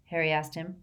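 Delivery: ordinary delivery, nothing marked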